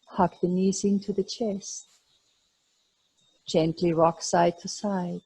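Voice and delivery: calm, unhurried class guidance; guided yoga cues. Acted. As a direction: lead the class gently through the movements. Guides gentle warm-up movements.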